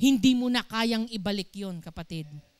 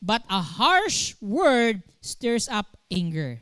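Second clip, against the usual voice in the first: scripture reading; 3 dB louder, slower than usual. Real, not acted. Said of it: "Sabi dito".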